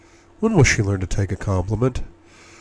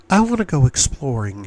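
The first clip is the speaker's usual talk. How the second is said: usual speaking voice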